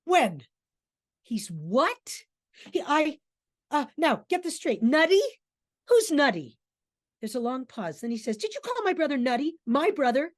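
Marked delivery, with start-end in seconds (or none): 0.1-6.6 loud, high, halting challenges; threatening. Acted. Mick challenges Davies over the word nutty, threatening. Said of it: "with amazing threat and says". 8.4-10.3 high, loud, incredulous questioning; threatening challenge. Acted. Mick confronts Davies for insulting his brother, threatening. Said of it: "with amazing threat and says".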